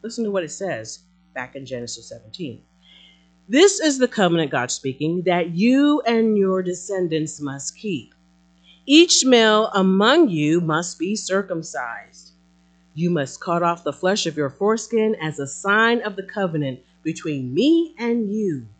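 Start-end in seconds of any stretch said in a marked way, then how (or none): 3.5-4.5 loud, high, brisk proclamation; forceful pulpit reading; scripture reading. Acted. Reads scripture aloud as God. 5.2-18.7 loud, emphatic proclamation; forceful, deliberate pulpit reading; scripture reading. Acted. Reads scripture aloud as God.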